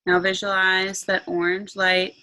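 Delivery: slow meditation voice with long pauses; guided meditation. Acted. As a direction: guide them calmly into relaxation.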